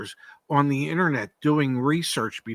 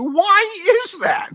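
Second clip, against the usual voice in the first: higher and louder, slow and drawn out; more emphatic rhetorical question; 6 dB louder, slower than usual. Real, not acted. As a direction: push it even higher, slowly. Repeats question, even more emphatic.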